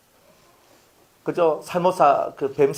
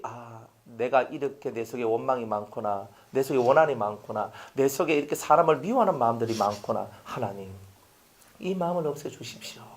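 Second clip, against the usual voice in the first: quiet repentant prayer; 8 semitones lower, 7 dB quieter than usual. Retold or imitated.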